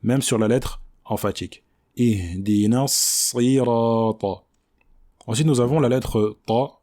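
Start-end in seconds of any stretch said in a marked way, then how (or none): 2.0-4.4 slow "Ihdina sirata", pure I on the emphatic S; full kasra. Shown as a demonstration. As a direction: draw out "Ihdina sirata" with a complete I. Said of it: "de bien prononcer cette kasra".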